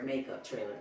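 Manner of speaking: usual speaking voice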